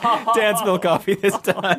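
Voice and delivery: laughing, high and slow. Real, not acted. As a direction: crack up while announcing. Real coffee spill laughing.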